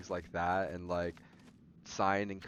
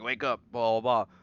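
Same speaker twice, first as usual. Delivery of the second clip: loud mock yell of a fed-up teacher; 7 dB louder than usual. Teacher Schultz yelling at sleeping students, loud mock shout. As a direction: bark it like a tired teacher waking kids. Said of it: "Mister Schultz would yell at them".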